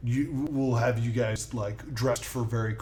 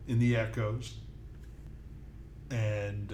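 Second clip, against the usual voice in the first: ordinary talk, nothing marked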